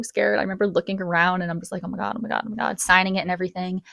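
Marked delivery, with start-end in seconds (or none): 1.8-2.8 soft, repeated anxious murmur; nervous murmur. Retold or imitated. Nervous client waiting for tattoo, soft anxious mutter.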